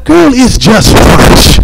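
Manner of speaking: high, drawn-out mock exasperation; exasperated protest. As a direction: protest to her, stretching the words upward. Protests her brush-off.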